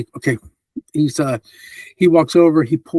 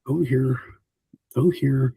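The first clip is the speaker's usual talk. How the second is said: ordinary delivery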